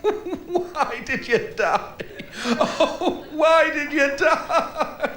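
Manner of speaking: slow, high hammed-up wail of grief; mock sobbing. Mourner wailing over a grave, hammed-up slow sobbing. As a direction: overdo a graveside lament, slowly. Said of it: "sobbing his heart out".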